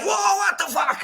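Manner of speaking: very high shouted outburst; outraged yell at the sealed car; shocked shout. Retold or imitated. Franz finds the seal on his car.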